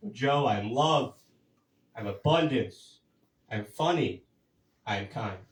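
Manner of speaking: slow, soft self-affirmation to the mirror. Retold or imitated. To himself in the mirror, soft slow affirmations. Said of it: "take another deep breath".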